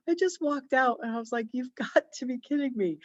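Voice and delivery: laughing through exasperation; half-laugh it, can't believe it; laughing disbelief. Real, not acted. Exasperated at sold-out tampons.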